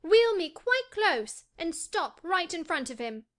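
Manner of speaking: high, drawn-out imperious order; commanding. A narrator's reading. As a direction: give orders as a haughty boy, pitched high. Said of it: "he commanded".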